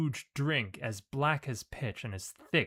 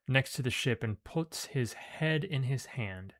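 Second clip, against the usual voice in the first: ordinary talk, nothing marked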